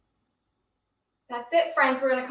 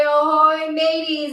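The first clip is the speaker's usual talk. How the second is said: louder sing-song pirate ahoy; pirate greeting; 4 semitones higher, 7 dB louder, slower than usual. Pretend. Teacher shows the kids a sing-song pirate hello. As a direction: hail the crew like a cheery pirate. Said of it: "and say".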